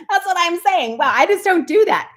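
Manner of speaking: high, bright exclamation of disbelief; excited. Real, not acted. Telling viewers she never runs, high and excited. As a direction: celebrate like you can't believe it. Excited about her surprising run.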